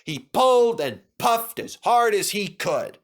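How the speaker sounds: ordinary delivery, nothing marked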